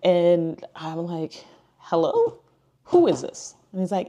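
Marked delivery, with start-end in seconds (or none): 1.8-3.5 very high, loud questioning; retold bafflement. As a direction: challenge the stranger, voice shooting up. Retold baffled reply to stranger online.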